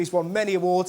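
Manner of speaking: ordinary talk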